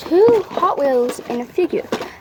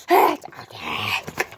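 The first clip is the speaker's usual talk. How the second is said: very low and slow; deep slow voice; 14 semitones lower, much slower than usual. Acted. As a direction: drag it out deep like a monster.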